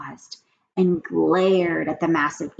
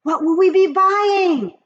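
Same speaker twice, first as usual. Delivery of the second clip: high loud eager question; eager witches; 13 semitones higher, 12 dB louder than usual. Acted. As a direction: beg to know. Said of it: "they cried".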